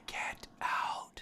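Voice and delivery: breathy unvoiced whisper; whispered film line. Quoting a horror film to friends, whispered. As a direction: hiss it like a horror movie ghost.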